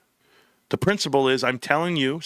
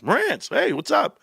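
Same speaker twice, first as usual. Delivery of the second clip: much higher, bright and welcoming hello; excited owner greeting; slower than usual. Retold or imitated. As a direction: greet an old friend like the owner. Owner greets him warmly.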